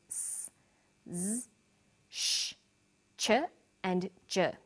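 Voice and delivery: letters voiced as their sounds, not their names; teacher sounding out "s, z, sh, ch, j" with pauses between; isolated consonant sounds. Shown as a demonstration. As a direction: say "s, z, sh, ch, j" as sounds, one at a time. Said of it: "after sounds such as".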